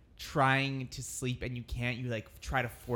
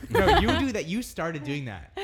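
laughing; 3 semitones higher, 7 dB louder than usual. Real, not acted. Laughing accusation of co-host.

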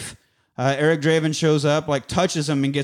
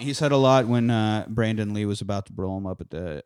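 normal talking voice

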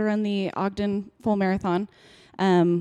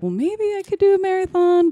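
high, eager musing; dreamily wondering aloud; hopeful thought; 10 semitones higher, 5 dB louder than usual. Retold or imitated. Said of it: "I kinda was like".

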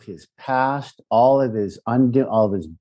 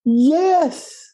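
emphatic yes. Real, not acted. Emphatic answer repeated.

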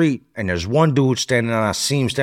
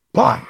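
punch sound; 12 semitones higher than usual. Retold or imitated.

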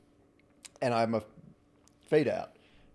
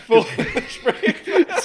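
squeaky laughing repeat; wheezing with laughter; 9 dB louder, much slower than usual. Real, not acted.

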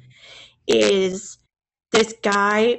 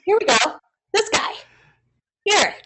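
mock presenter; 9 semitones higher, faster than usual. Presenting the odd god.

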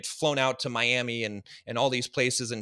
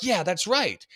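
higher-pitched mock rallying agreement; raised-pitch, fired-up echo; imitated fired-up agreement; 7 semitones higher, slower than usual. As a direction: imitate someone getting riled up in agreement. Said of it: "they get fired up about that".

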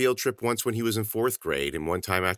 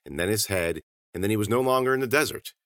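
usual speaking voice

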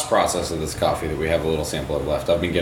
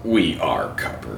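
commercial parody voice; slower than usual. Retold or imitated. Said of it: "that's hilarious".

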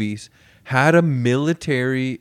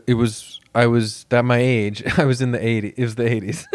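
usual speaking voice

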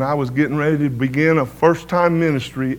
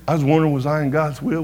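ordinary talk

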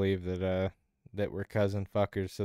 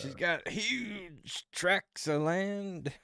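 high, slow hammed-up posh voice; drawn-out silly highborn voice; mock posh quote; 9 semitones higher, 4 dB quieter, much slower than usual. Monty Python quote, silly voice.

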